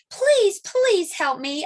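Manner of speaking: pleading. Acted. Boy begs Mister Carp.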